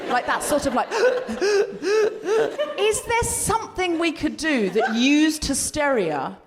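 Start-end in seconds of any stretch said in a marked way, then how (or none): 0.9-2.7 rapid, high, hysterical gasping; hyperventilating gasps. Shown as a demonstration. Host demonstrating hysteria that frightens men, hyperventilating gasps. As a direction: gasp over and over as if hyperventilating. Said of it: "like hyperventilating".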